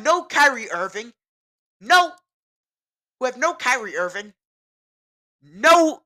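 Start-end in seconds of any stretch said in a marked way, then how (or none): 1.8-2.1 a sharp loud "No"; loud emphatic no. Real, not acted. Emphatic correction in rant. 5.4-6.0 a loud, higher "No"; loud emphatic no. Real, not acted. Emphatic correction in rant.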